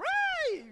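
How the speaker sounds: very high, sustained mock squeal; shrill child-like shriek of reunion; high-pitched squeal. Lost child spotting a parent at the mall, squealing.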